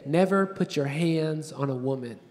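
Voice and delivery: lightly voiced dad, low and soft; hint at a father's solemn refrain; fatherly advice. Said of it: "That's what he'd say to me every day".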